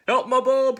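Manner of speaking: high, very loud yelp; panicked fox. Acted. Todd catching sight of gruffalo, high loud panic. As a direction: cry out in storybook fright.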